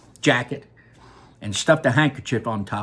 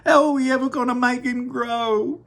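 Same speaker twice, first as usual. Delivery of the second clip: wailing; 10 semitones higher, 3 dB louder than usual. Acted. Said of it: "wailed Missus T V".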